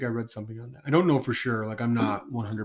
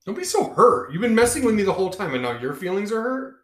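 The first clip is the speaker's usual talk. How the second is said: louder, higher mock indignation; mock outrage; 9 semitones higher, 8 dB louder than usual. Real, not acted. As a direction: play at being offended.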